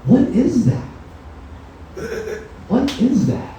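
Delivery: high-pitched, swooping mock disbelief; exaggerated astonishment at an unfamiliar name. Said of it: "They're like".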